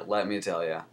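usual speaking voice

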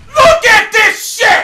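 high, loud, drawn-out yell; slow, high-pitched rage scream; outraged shout. Real, not acted. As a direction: cry out in real outrage at the game. Player outraged at the game.

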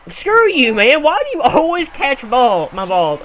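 high, loud outburst of annoyance; frustrated shout. Real, not acted. Player scolding fielder who always catches, frustrated shout. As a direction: yell at the fielder in exasperation.

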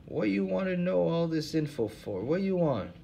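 put-on old hobo, voice pitched well up. Hobo Lou Jenkins to the interviewers, in a high put-on voice. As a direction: play the suspicious bum, pitched high.

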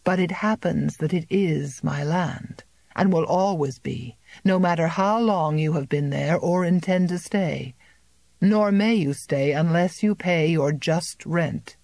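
plain reading voice

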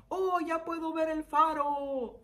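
high, drawn-out relieved sailor call; voice of the boats. Acted. Boats spotting the lighthouse for lost sailors, high drawn-out exclamation. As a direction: play a boat spotting the light, high and slow. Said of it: "Dicen los barcos".

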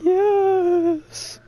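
lightly spooky ghost voice; a hint of a moaning ghost; ghoulish voice. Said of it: "That was my ghoulish voice, my ghostly yes".

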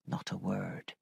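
very low, soft, dejected answer; subdued reply. A narrator's reading. Missus Grose answering about Miss Jessel, subdued. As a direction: drop the voice right down, disheartened.

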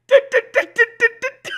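high, stuttered "du-du-du"; brain short-circuiting. Acted. Streamer mimics own brain stalling, high stuttering noise. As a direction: imitate a stuck brain stuttering. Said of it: "My brain just had a moment of going".

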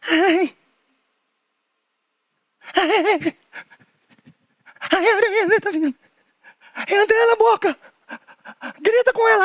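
high squealing hammed-up fright; high playful alarmed voice; mock panic. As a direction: squeal like the spider is coming at you. Scared as spider comes near.

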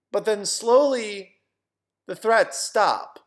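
slow, weighty dramatic build; slow suspense. Retold or imitated. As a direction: stretch each word for suspense. Suspense as the threats die away.

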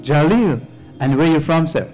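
ordinary talk

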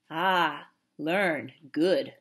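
low, measured approval; approving. A narrator's reading. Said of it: "said Little Bear approvingly".

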